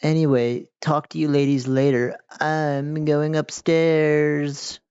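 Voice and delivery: hammed-up slow character greeting; put-on character voice. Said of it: "I don't know why she talks like this".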